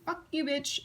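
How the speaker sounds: high jokey curse; mock snarl. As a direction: spit it out as a joke. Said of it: "That's basically saying".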